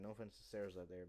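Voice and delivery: ordinary delivery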